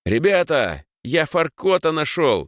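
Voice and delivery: high, excited, drawn-out call. A narrator's reading. Эдвард announces his find to two boys, calling out. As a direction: call out the news, stretching the words. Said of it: "закричал Эдвард".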